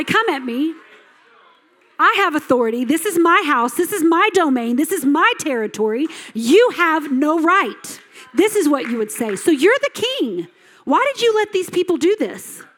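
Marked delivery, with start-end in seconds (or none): 0.0-8.0 raised, louder voice, stressing each MY; defiant challenge. Acted. Homeowner facing down a murderer in the house, defiant. 9.4-12.6 high-pitched, indignant questioning; indignant challenge. Acted. Preacher confronting King Saul over the people's actions, indignant.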